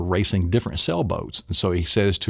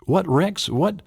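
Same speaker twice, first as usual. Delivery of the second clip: high, questioning what; baffled by the dog; mock confused owner; puzzled owner; 9 semitones higher, slower than usual.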